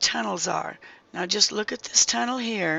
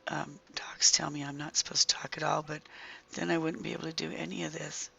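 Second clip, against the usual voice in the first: hoarse half-whisper from sore throat; croaky throat; 2 semitones lower, 4 dB quieter than usual. Real, not acted. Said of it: "I'm sorry about my croaky throat".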